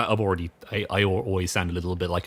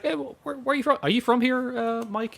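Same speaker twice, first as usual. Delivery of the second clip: much higher voice, held on one high note; imitated coworker. Guy at work asking where he's from, imitated high-pitched. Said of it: "I remember one guy actually at work".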